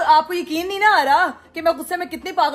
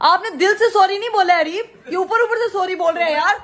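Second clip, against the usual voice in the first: higher and louder, laughing while protesting; laughing complaint; 6 semitones higher, 4 dB louder than usual. Real, not acted. Wife laughs while rejecting her husband's half-hearted sorry. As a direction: laugh as you scold him.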